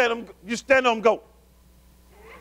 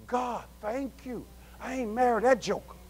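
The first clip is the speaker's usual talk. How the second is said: hushed relieved thanks; 2 semitones higher, 8 dB quieter than usual. Acted. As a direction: breathe it out softly, as if privately to God. Acting out private relieved thanks.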